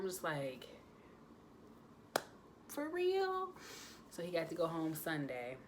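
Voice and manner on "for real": very high, thrilled squeal; joyful disbelief. Retold or imitated. Mom hearing her son can come home, delighted surprise.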